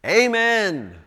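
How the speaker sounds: loud, high, drawn-out Amen; ringing call of Amen; congregational shout. Acted. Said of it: "You talk about a shouting time".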